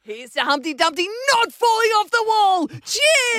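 mock pub toast in a toddler's voice. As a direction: raise a glass like a tipsy toddler. Acting toddlers' cheers at table.

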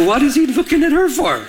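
high-pitched jealous indignation; upset. Retold or imitated. Fiancé seeing worship guy eye his wife-to-be, upset. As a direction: act out indignant disbelief, pitch jumping up. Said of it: "And now I'm upset".

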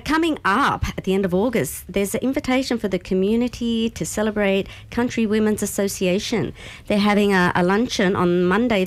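ordinary delivery

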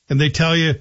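ordinary talk

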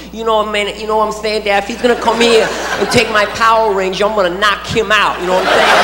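put-on lisping little-kid boxer talk; Mike Tyson. Small son threatening to knock out his brother, lisping boxer impression. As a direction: imitate a child with a slight speech impediment. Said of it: "sounds a little bit like Mike Tyson".

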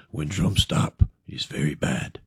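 deep breathy whispered warning; ominous whisper. Retold or imitated. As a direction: hush it low and dark. Tribesman repeats warning, punchline setup.

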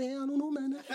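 imitating shrug answer. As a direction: say it vaguely with a shrug. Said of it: "Hey, you going out?".

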